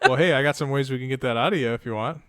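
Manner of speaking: loud, laughing through a fake threat; mock scary uncle. [name] teasing the boy about pulling his tooth, laughing and loud. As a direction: tease the kid, laughing as you go. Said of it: "scare the shit out of him".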